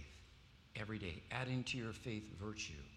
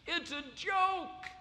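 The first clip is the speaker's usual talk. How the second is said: high, loud, slow cry; preacher warning against empty confession, shouting; preacher's shout; 7 dB louder, slower than usual. Acted. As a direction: shout the rebuke over the congregation.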